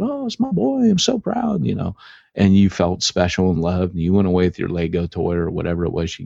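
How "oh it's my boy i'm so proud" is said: high, gushing proud mother voice; proud mom. Retold or imitated. Mother praising her son over a gift, high gushing coo. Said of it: "gave it to you and said".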